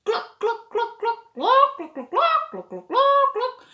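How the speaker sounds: high clucking like a hen; chicken clucking. Shown as a demonstration. Imitating a hen for children, high clucks. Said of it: "Listen to the sound".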